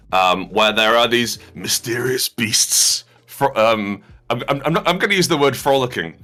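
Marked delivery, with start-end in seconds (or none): none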